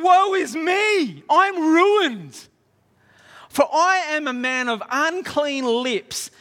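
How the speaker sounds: loud, high acted cry of dread, easing off; freaking out. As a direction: wail it out as the doomed prophet. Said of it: "freaking out. He's going".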